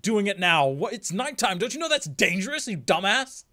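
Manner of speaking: high, loud mock outrage; hammed-up exasperated scolding; indignant tough guy. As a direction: berate them in disbelief, pitch jumping up. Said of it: "made me think of him going like".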